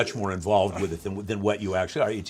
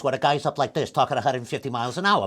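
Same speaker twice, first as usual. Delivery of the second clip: rapid-fire, pitched-up tough guy; Joe Pesci; 2 semitones higher, faster than usual. Shown as a demonstration. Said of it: "doing the Joe Pesci voice".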